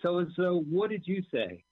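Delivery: high sharp demand. Retold or imitated. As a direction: call him out sharply. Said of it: "she goes running and she goes".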